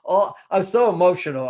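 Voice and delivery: loud, dramatic emotional outburst; hammed-up venting of feelings. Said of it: "being very dramatic about it".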